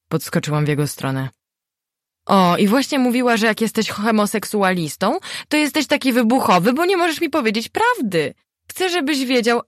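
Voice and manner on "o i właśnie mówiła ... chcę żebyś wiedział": much higher, earnest mother's lecture. A narrator's reading. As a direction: talk over him like an anxious mother. Matka tłumaczy synowi. (wysoki, przejęty głos matki)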